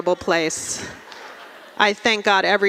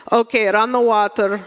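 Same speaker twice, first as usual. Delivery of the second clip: paranoid Russian dad. Acted. Dad phones [name] fearing KGB taps, paranoid Russian accent. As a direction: give secretive orders in a Russian accent. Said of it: "he'll call me and be like".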